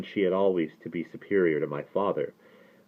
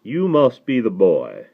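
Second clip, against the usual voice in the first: higher, louder Southern drawl, drawn out slowly; mellifluous Southern accent; 4 semitones higher, 7 dB louder, slower than usual. A narrator's reading. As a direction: greet the boy like a courtly Southern rabbi. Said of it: "talk in that accent".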